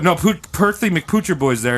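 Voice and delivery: ordinary delivery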